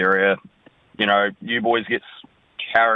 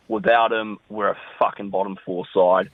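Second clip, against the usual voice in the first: ordinary delivery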